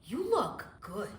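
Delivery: slow, low flirty "You look good."; flirty compliment. Retold or imitated. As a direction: lay on the charm, slowly. Flirting with undercover cop.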